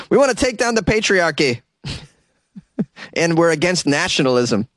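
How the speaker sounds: high, eager voice of clueless activists; mock activist voices. Activists excitedly pitching a stunt to each other, high mock voice. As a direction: play it like a naive brainstorm, pitched well up.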